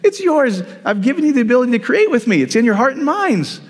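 high, insistent divine voice; preacher voicing God's answer, high and firm. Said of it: "he's going".

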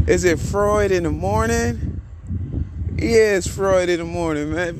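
excited greeting. Real, not acted. Excited greeting of friend Freud.

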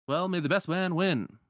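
high, showy send-off; tournament producer. Acted. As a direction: send the fighters off like an emcee. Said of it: "And then the producer".

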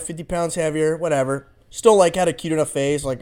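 ordinary talk

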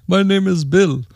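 imitated Indian phone agent; mock Indian accent. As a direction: put on a call-centre Indian accent. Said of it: "hardcore accent".

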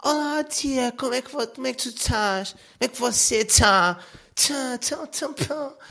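sing-song Cascais snob greeting an aunt; Cascais accent imitation. Vlogger imitating a Cascais accent to mock it, high. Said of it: "ninguém fala assim".

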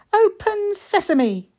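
high, slow, grand magic-words voice; conjurer's magic call. Acted. Anna playing conjurer for her little brother, grand and slow. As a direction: wave it like a stage magician.

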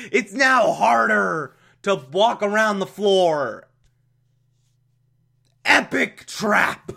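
high slow gloating villain; cackling villain, drawn out; mad scientist. Acted. Voicing Penguin's gloating trap.

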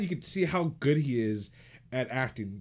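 normal talking voice

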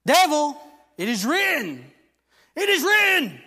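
loud, high, ringing proclamation; preaching at full force. Acted. Preacher to congregation, loud ringing proclamation.

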